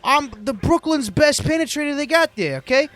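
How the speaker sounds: put-on Brooklyn accent. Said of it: "this fucking Brooklyn guy".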